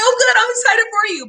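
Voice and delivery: bright, high, eager congratulations; mock excited reply. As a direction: act out gushing over the news. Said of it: "I'm like".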